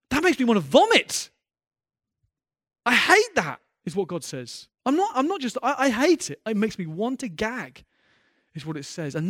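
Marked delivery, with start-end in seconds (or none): 0.1-3.6 loud and higher, spitting out the disgust; disgusted shout. Acted. Preacher voicing God's disgust at the lukewarm, shouting. 4.8-7.8 loud and higher, spitting out the disgust; disgusted shout. Acted. Preacher voicing God's disgust at the lukewarm, shouting.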